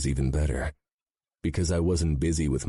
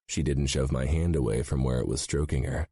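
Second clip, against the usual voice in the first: plain reading voice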